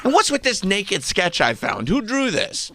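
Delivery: high, accusing put-on voice; mock jealous fiancé. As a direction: demand answers in a squeaky jealous voice. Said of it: "He's like".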